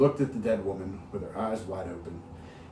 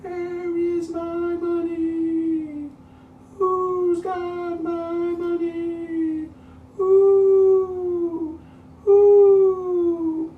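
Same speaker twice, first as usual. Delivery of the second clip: very high, very slow, louder moaning wail; ghostly wail; 10 dB louder, much slower than usual. Acted. Dead woman's ghost wants her money, high slow wail. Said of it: "It was a voice crying".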